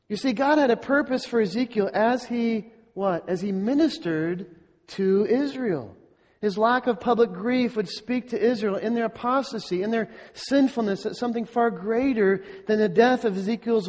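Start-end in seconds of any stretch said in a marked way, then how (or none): none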